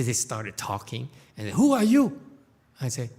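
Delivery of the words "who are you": eager. Acted. Eager question to stranger.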